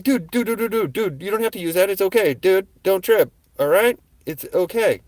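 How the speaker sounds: high, rapid pleading dude dude dude; talking a gunman down in panic; high, loud placating; frantic calming. Retold or imitated.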